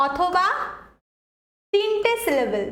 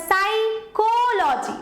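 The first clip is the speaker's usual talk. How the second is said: slow psychology with a long, stressed কো; 3 semitones higher, much slower than usual. Shown as a demonstration. Teacher repeating the psychology example for students, stress on কো. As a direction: stretch the কো in psychology. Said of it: "CHO তে জোর বেশি পড়ছে".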